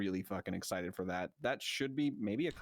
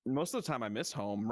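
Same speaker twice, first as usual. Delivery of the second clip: ordinary talk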